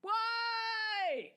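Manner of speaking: high, loud wail of despair; mock anguished cry. Host mock wailing at the dog's death, anguished cry. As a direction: cry out to the heavens over the dog.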